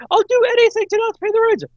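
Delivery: very high and fast, frantic damsel. Pretend. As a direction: gush it out like a desperate heroine. Melodrama damsel pleading.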